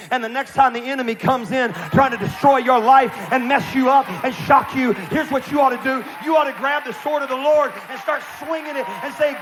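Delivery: high, loud preaching at full force; rally the congregation; fiery preaching. Real, not acted. Pastor urging the church to fight back, fiery preaching. Preaching spiritual warfare aside.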